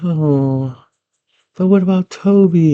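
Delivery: high-pitched mock girl voice; acted girlish concern; worried girl. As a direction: plead high, as the girl in the story. Girl remembers her brother, acted.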